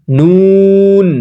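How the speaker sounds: নুন held long and steady on the n; drawn-out nun. Shown as a demonstration. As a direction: stretch নুন slowly on one pitch. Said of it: "ভালোভাবে লাগাবেন". (নুন লম্বা টেনে)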